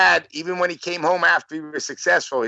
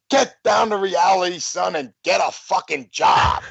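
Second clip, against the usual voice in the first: angry father outburst; 3 semitones higher, slower than usual. Retold or imitated. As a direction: bellow it like a fed-up father. Retold father slamming table, angry outburst.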